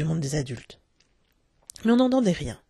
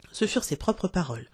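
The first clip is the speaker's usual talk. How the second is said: ordinary delivery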